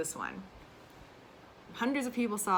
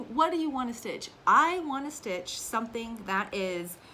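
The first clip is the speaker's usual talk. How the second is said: ordinary talk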